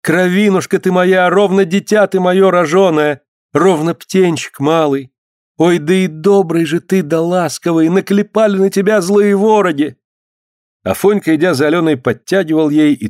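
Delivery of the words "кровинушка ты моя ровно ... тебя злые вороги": high, sustained singsong keening; keen over him phrase by phrase; acted peasant wail; lamenting. A narrator's reading. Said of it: "запричитала Алёна".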